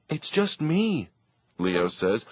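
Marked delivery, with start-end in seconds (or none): 0.1-1.1 put-on sheepish boy. Leo answering Papa.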